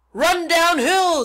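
loud, high, drawn-out cow call. A narrator's reading. Cows advising the runaway pig, loud drawn-out call. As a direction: stretch the words into a big bovine holler. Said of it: "suggested the cows".

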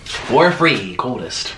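ordinary talk

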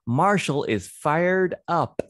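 bright lifted read of fired up; excited reading. Shown as a demonstration. Teacher reads the story line to kids with excitement. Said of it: "One more time".

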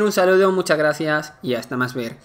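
ordinary delivery

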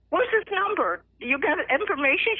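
high, urgent excited questions; eager. Retold or imitated. Mother asking daughter for his number, eager.

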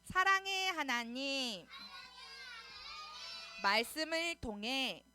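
slow chanted prayer in short phrases. Real, not acted. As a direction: lead the prayer phrase by phrase, stretching each ending. Leads children in repeat-after-me prayer.

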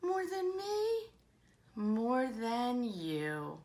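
bouncy rhyming read-aloud; enthusiastic story reading. Acted. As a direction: relish each rhyme for young listeners.

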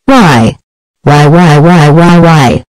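angry yelling parent. Acted. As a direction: yell at the kid for wrecking it. Parent scolding Nutty after destruction.

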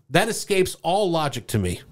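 ordinary delivery

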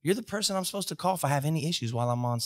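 high, fast furious outburst; mock-furious rant at the sergeant; angry rant. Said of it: "So now I'm mad".

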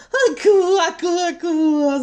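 high croaking laugh; laughing frog. Acted. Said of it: "засмеялась лягушка".

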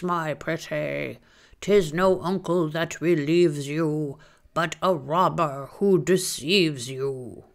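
slow, strange croaking bird voice; croaking crow. Acted.

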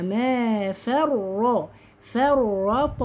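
"ما فرا"، "فرط" slow, with a bouncing final t; light fa, heavy ra. Shown as a demonstration. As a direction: stretch "ما فرا" and echo the t of "فرط". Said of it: "والطاء ساكنة تبقى مقلقلة".

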